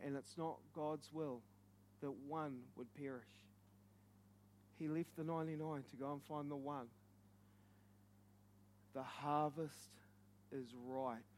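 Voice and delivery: low, soft, with long pauses; hushed, solemn preaching. Real, not acted. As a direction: let it sink in quietly and gravely. Hushed solemn close after climax.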